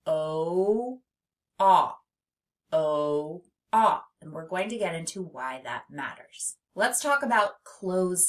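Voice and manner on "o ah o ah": "O-ah" as drawn-out oh, then short ah; isolated long and short sounds in "O-ah"; vowel contrast demo. Shown as a demonstration. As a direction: hold the O, pause, punch the ah. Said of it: "difference between those two O's".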